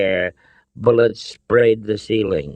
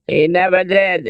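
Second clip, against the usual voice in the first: high, raised exasperated outburst; 10 semitones higher, 5 dB louder than usual. A narrator's reading. Father gripes about his son, exasperated. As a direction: complain loudly, pitched up. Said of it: "in an exasperated tone".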